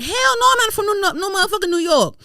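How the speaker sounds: high, loud indignant denial; mock outrage. Telling co-hosts she is not from New York, indignant. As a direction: deny it loudly, comically offended.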